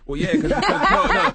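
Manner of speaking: laughing while starting to answer; chuckling through the words. Real, not acted. Laughing, eager to tell a story.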